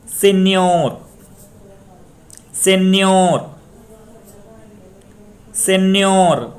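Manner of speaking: सेन्योर held high on a long न्योर; stress on last syllable. Shown as a demonstration. Teacher demonstrates final-syllable stress, stressing न्योर in सेन्योर. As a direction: stretch and lift the न्योर of सेन्योर. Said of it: "सेन्योर में न्योर पर".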